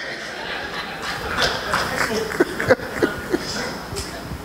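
breathy amused chuckle; soft laugh. Real, not acted. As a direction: laugh at the absurdity. Preacher laughs after punchline.

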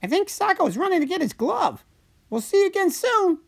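very high cartoon character voice; yelling back cheerfully. A narrator's reading. Slurpee waves goodbye to the giant, calling back high. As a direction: call back in a squeaky-high voice. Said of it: "waving and yelling back".

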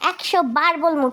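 ordinary talk

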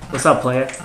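loud friendly call to the roommate; loud greeting. Real, not acted. Roommate walks in, loud greeting. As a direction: greet him loudly across the room.

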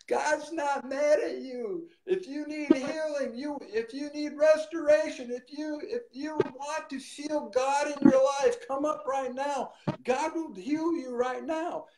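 high, ringing altar call; preaching proclamation. Retold or imitated. Preacher calling the street crowd up for healing, high proclaiming voice. Retold word from God to crowd.

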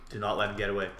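low self-urging. Real, not acted. Player coaching himself in the chase, low and quiet. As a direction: say it low to yourself.

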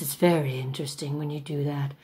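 usual speaking voice

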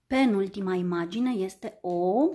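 usual speaking voice

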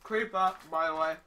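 loud, urgent heads-up; loud warning. Real, not acted. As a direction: call out the danger. Warning teammate of creeper.

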